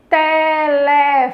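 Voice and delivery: "Telefone" drawn out slowly, te-le-FO-ne; slow, projected classroom chant of "Telefone"; voz alta, syllable by syllable. Shown as a demonstration. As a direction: stretch each syllable of "Telefone", holding fo. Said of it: "você fala em voz alta".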